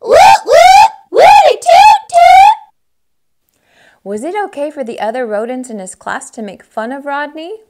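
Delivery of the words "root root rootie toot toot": very high, very loud cheer; squealing triumphant hoots; jubilant cry. Acted. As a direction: whoop it like a celebrating crowd. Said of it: "they cried".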